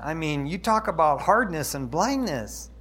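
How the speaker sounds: ordinary delivery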